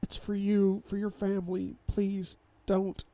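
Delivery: high, thin-voiced pleading; tearful plea. A narrator's reading. Wife pleads for his family, tearfully. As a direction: beg through tears, pitched well up.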